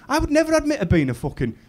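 high-pitched indignant declaration; impassioned protest. Acted. Comic tells his lass he's proud, impassioned protest. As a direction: build from high protest to loud pride.

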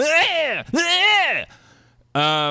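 loud, high wordless scream; two bursts of yelling at the chair; demonstrated venting yell. Shown as a demonstration. Patient showing how he yells at the therapist's chair, venting scream. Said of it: "I guess if I want to, I can go".

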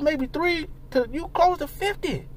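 ordinary talk

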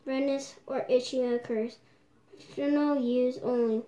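mask over mouth; slightly muffled lips; talking through a sheet mask. Real, not acted. Seen 2: sheet mask covers face including mouth area throughout.

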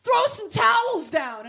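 high, slow, loud command; friend's order. Retold or imitated. Friend directing from across bathroom, high slow command. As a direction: quote the friend high and bossy. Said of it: "she's like".

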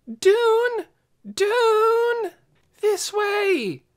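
high loud call across the field. A narrator's reading. Lena calling to Dune in the dark, shouting. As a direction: shout his name to find him. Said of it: "Here she shouted again".

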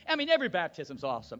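normal talking voice

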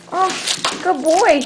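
ordinary delivery

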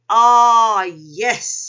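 loud, drawn-out "ah" of realisation; big, loud moment of discovery; exclaiming. A narrator's reading. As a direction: exclaim it as the answer dawns. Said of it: "he exclaimed".

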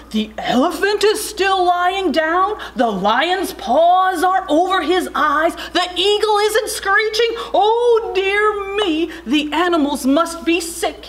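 high, dismayed keeper wail. A narrator's reading. Keeper fears animals are sick, wailing. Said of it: "he wailed".

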